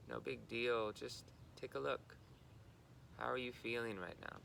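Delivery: unhurried guidance, level in pitch, pausing between phrases; guided meditation. Real, not acted. Guiding listeners into body and breath, calm meditation delivery.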